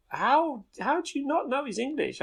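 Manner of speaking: high-pitched disbelieving question; incredulous. Retold or imitated. Reacts to Americans' not knowing.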